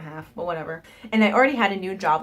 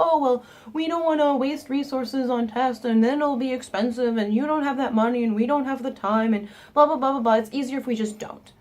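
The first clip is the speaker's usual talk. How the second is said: higher, hurried excuse-making doctor voice; dismissive doctor; 4 semitones higher than usual. Retold or imitated. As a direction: rattle off lazy excuses as the doctor. Doctor voiced in retold story.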